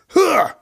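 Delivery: shrill high loud mock gasp; hammed-up horrified gasp. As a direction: gasp like a shocked prissy guy.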